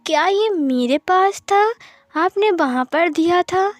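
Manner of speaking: astonished questions. A narrator's reading. Wing amazed questions.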